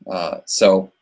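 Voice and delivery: ordinary talk, nothing marked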